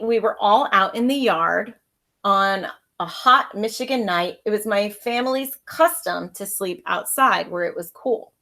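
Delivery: ordinary delivery, nothing marked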